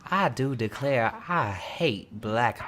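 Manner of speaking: put-on slow, drawn-out genteel Southern accent; mock a rich Southerner, drawling slowly. Imitating a rich slave owners' descendant, Southern drawl. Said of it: "so much more with that accent".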